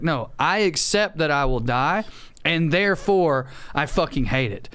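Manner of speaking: ordinary talk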